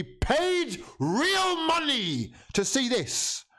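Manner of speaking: high, slow, stretched disbelief; outraged squeal. Real, not acted. Fan outraged at own team.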